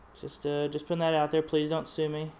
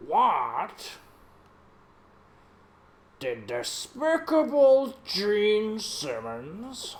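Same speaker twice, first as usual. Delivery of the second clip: Neil Hamburger voice; 3 dB louder, much slower than usual. Retold or imitated. Artist roasting [name] in Neil Hamburger's voice. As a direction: drag out each insult like a bitter old comic. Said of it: "my impersonation of Neil Hamburger".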